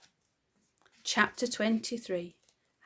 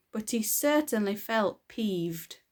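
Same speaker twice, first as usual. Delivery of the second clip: usual speaking voice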